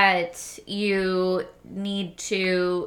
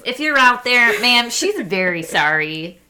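loud, slowed address to an absent stranger; mock public apology; 4 semitones higher, 7 dB louder than usual. Apologizing to a stranger on a friend's behalf, mock-loud.

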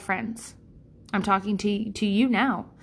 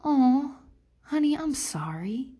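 tender sympathy; 5 semitones higher than usual. Retold or imitated. Sister-in-law comforting a hurt friend, tender. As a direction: comfort her gently, meaning it. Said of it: "she didn't answer anything except for".

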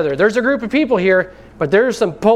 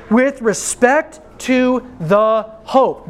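slow, drawn-out stress on "the hope"; slow emphasis; 4 semitones higher, much slower than usual. Shown as a demonstration. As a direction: lean on "with respect to the hope" slowly. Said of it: "notice this".

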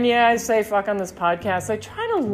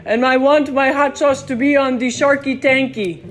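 a light, louder impression of a local guy pitching his sauce; imitating Malik; 4 semitones higher, 5 dB louder than usual. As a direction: lightly voice the shop owner, no real accent. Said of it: "I can't do the accent of the, uh, Caribbean".

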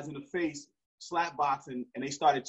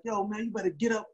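higher, threatening order; mad warning; 6 semitones higher than usual. Retold or imitated. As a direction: run him off. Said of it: "Now I'm, now I'm mad".

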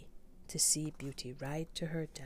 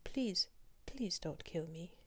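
higher, stammering plea; frightened pleading; 6 semitones higher than usual. Acted. Merchant pleads with the Beast for his life, higher and frightened. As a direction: beg for mercy, voice up.

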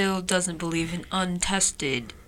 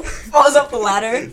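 ordinary talk, nothing marked

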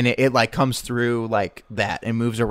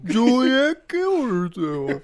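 higher, slow theatrical lament; dramatic tragedian; 10 semitones higher, much slower than usual. Acted. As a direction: declaim it like a melodramatic actor. Hamming Shakespeare tragedy.

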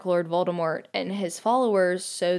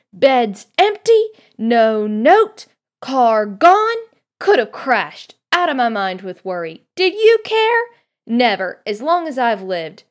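loud, high mother's telling-off; frantic, furious mum letting rip; yelled scolding; 4 semitones higher, 9 dB louder than usual. A narrator's reading. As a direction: scold the kids at full volume, worry spilling out. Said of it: "yelled Missus Weasley".

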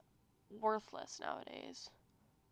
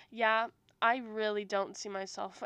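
ordinary delivery

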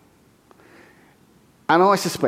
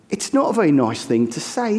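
ordinary talk, nothing marked

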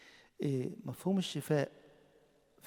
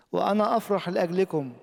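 loud, raised reading of the verse; proclaiming scripture; 5 semitones higher, 10 dB louder than usual. Acted. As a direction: call the words out to the whole church. Preacher reads Jesus' words.

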